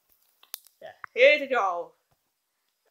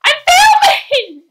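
loud, high furious shout; shouting get out; 17 dB louder, slower than usual. Real, not acted. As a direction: order dad out at full volume.

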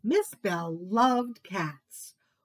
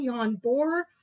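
plain reading voice

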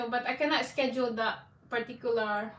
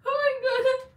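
disgusted exclamation; 13 semitones higher, 5 dB louder than usual. Real, not acted. Reacting to the nasty memory.

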